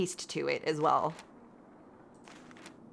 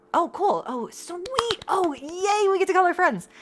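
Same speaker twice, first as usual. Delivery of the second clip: delighted squeal, much higher; excited; 12 semitones higher, 4 dB louder, slower than usual. Real, not acted. Streamer thrilled she gets to phone her friends, squealing high. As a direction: gush with delight.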